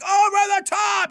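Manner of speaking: sudden high, loud shout; over-the-top shouted burst; loud yell. Shown as a demonstration. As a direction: belt it out as if cheering someone on. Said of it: "or you have to yell really loud".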